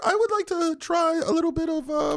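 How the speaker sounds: high falsetto lady voice. Pretend. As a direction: squeak it out like a prim bonnet lady.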